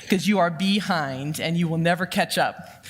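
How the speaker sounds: normal talking voice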